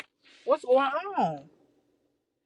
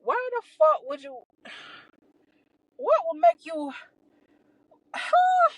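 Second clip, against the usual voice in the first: exasperated sighs rising to a squeaked huh; appalled; 6 semitones higher than usual. Retold or imitated. Staring at the imaginary camera, appalled and sighing. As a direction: sigh it out in disbelief.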